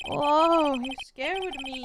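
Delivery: sudden loud whoa, then slow; jumping at a cat that startled her. Real, not acted. Cat owner startled by her cat, loud sudden whoa.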